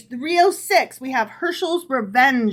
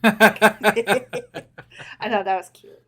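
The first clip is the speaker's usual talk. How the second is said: laughing through the words; chuckling as you speak; 3 dB louder, much slower than usual. Real, not acted.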